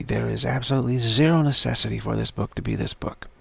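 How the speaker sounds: "zero" punched hard, "absolutely" leaned on; adamant. Shown as a demonstration. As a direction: drive home "zero". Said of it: "to get the feeling of being very adamant".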